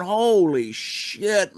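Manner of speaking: slow, high mock astonishment; retold shock. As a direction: stretch it out, reliving the jolt. Retold shock of first snort.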